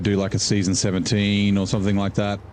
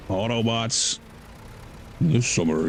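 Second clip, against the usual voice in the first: Autobots with a more gravelly, softer Optimus rasp; gravelly Peter Cullen voice; 4 dB quieter than usual. Shown as a demonstration. Showing chat how gravelly the impression gets, gravelly Optimus voice. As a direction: roughen it up like Optimus Prime. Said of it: "a little bit more, like, gravelly".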